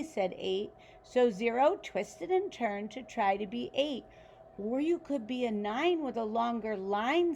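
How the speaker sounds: put-on reading a picture book aloud to children; story narration. A narrator's reading. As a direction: tell it like a bedtime story for kids.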